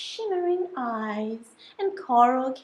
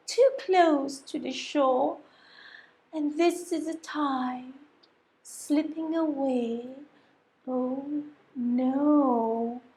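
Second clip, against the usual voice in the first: usual speaking voice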